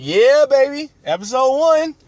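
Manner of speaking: put-on high, hyped-up kid cheering; excited cheer. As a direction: cheer it like a thrilled young fan. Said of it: "And I was like".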